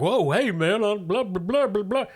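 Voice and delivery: high-pitched, placating neighbor babble; startled neighbor. Acted. Neighbor reacting to an armed guard, startled high babble. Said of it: "this guy who lives in your building is like".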